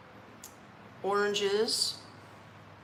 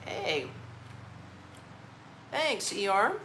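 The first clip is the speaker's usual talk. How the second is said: ordinary talk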